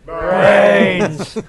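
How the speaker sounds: loud, drawn-out zombie moan; zombie groan. Pretend. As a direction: moan brains like the undead. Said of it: "Zombie activism".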